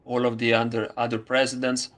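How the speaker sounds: usual speaking voice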